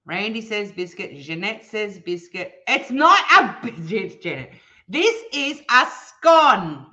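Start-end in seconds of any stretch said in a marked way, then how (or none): 2.7-4.5 laughing, loud insistence; laughing protest. Real, not acted. Laughing as she corrects Janet in chat. Arguing scone versus biscuit with viewers. 4.9-6.9 slow, louder, higher insisting; slow emphatic correction. Real, not acted. Slowly correcting Janet's biscuit claim, emphatic.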